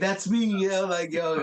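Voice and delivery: hyped outburst, held back in volume; retold excited scream. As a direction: re-enact jumping up thrilled at seeing your work onscreen.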